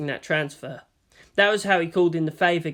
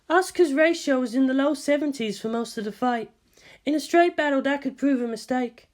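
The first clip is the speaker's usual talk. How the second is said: higher, composed scientist voice; Ritsuko's voice; 9 semitones higher than usual. A narrator's reading. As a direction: speak as the measured senior scientist.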